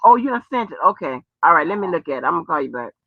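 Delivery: ordinary talk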